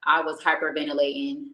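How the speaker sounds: ordinary delivery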